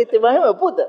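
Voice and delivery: high, quick, playful swearing; admiring insult. Shown as a demonstration. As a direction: toss the curse out as praise. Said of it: "Eso es chévere".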